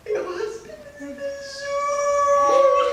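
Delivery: very high, slow, drawn-out wail; hammed-up wife in tears; mock crying wail. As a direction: wail it out theatrically, stretching the last word. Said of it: "why are you crying?".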